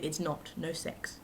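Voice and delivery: breathy whispered no sex; hushed aside to the camera. Real, not acted. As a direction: drop to a secret whisper.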